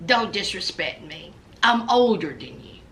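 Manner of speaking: lightly voiced boastful older man; mock Jason voice. Mocking Jason's attitude toward Travis, low soft voice. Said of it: "That's his mentality".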